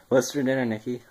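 a cartoon-high voice from helium; helium squeak. Real, not acted. Inhaled helium, laughing.

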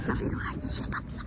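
unvoiced whispered aside; whispering. Real, not acted. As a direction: whisper it under the breath. Muttered aside to camera.

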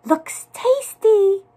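high, loud, stretched mouseling voice; slow high squeal of appetite; crying out. A narrator's reading. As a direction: draw it out with hungry glee. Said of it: "they cried".

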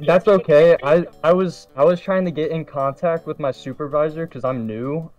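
ordinary delivery

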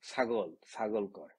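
ছাগল said with an s sound, "sagol"; colleague's mispronunciation. Retold or imitated. Imitating a colleague who can't say chh, ছাগল as "sagol". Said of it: "চ আর ছর উচ্চারণ পারে না".

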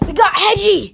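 high happy shout of relief; excited cry. Pretend. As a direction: cheer out the find. Finding the lost toy.

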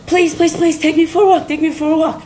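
high, begging dog voice; pleading dog. Acted. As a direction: plead over and over like an eager pet. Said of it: "And the dog's like".

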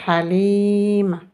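"Halim." with a long held i; long vowel. Shown as a demonstration. Teacher reads a first name for learners, "Halim." with long i. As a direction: stretch the second syllable of "Halim.". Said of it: "essayons ensemble maintenant de lire les prénoms".

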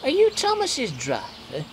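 high, slow, timid question; shy old coach. A narrator's reading. Old coach asking the driver, shy. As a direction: ask timidly, taking time. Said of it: "replied the coach shyly".

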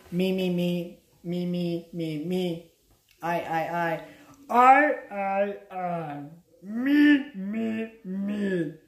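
lightly voiced Hook-style "me, me" chant; a hint of Captain Hook chanting "me, me"; Dustin Hoffman quote. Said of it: "trying to quote Dustin Hoffman from Hook".

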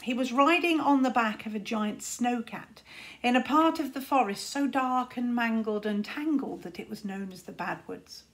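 plain reading voice

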